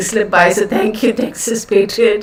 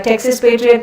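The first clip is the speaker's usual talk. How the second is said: ordinary delivery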